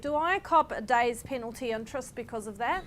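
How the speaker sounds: normal talking voice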